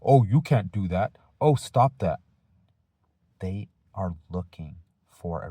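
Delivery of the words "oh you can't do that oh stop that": loud, higher mock nagging at a pupil; mock scolding. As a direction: ham up the fussy teacher yelling at every little thing. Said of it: "try to say".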